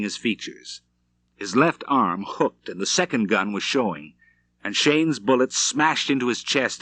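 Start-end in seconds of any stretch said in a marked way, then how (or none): none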